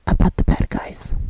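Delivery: unvoiced, secretive whisper. Vlogger whispers the punchline of her running gag. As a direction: drop to a conspiratorial whisper. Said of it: "I have this running gag".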